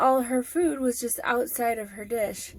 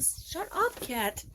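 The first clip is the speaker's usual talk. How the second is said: high, quick mock scold at the cat; teasing shut-up to a cat; 5 semitones higher, 5 dB quieter, much slower than usual. Real, not acted. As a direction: playfully shush the pet. Cat interrupts her sign-off.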